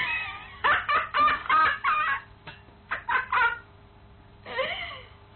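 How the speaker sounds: a long, very high burst of scornful laughter; high mocking laugh. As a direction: laugh the rival down.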